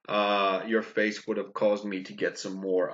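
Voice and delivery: ordinary talk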